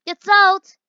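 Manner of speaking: loud, high shout for salt; urgent shout. Real, not acted. Player shouting at teammate to grab the salt, loud. Urging in cooking game.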